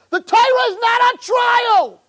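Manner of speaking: high, loud shouted preaching; fiery shout. Real, not acted. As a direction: cry out The Torah is not on trial. Preacher's climax declaration.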